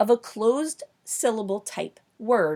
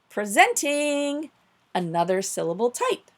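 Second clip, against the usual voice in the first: show announcer; 3 semitones higher than usual. Pretend. Teacher introduces the next syllable type, grand announcer style. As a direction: draw it out like a show host.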